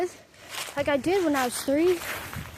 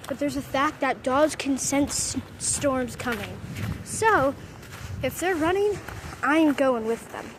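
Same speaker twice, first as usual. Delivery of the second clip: ordinary talk, nothing marked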